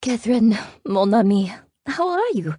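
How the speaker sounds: put-on high, lilting French-accented greeting; elegant French accent. A narrator's reading. French friend greeting her at the door, elegant accent. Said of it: "in that elegant accent".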